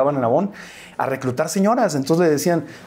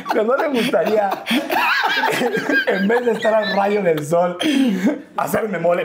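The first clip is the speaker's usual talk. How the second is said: laughing; 7 semitones higher than usual. Real, not acted. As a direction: giggle while you say it. Laughing at the mole joke. (riendo de verdad mientras habla)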